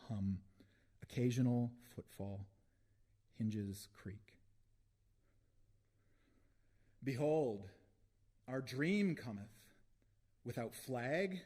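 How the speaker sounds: deliberate recital rising into proclamation; poem reading. Acted. Poet proclaims a dream to an audience, rising declamation. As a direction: declaim in short spaced phrases, lifting at the end.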